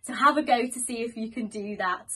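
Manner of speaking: ordinary talk, nothing marked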